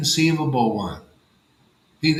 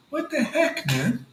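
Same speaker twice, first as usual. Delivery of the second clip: incredulous reaction; 6 semitones higher than usual. Retold or imitated. Retells his incredulous reaction to them.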